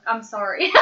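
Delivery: usual speaking voice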